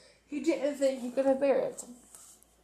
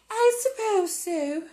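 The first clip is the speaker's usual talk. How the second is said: stiff, higher and clipped; stiffly; 8 semitones higher than usual. Acted. Wife answers husband stiffly about her sister's son. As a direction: say it coldly, stiffly. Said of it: "said Missus Dursley stiffly".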